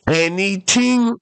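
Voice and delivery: high, drawn-out incredulous question; amazed question. Acted. Castaway questioning the genie's offer, high amazed voice. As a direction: ask it in high, slow wonder, rising. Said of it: "And the guy's like".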